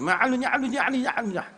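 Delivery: high, laughing gibberish Arabic; high laughing mock-Arabic babble.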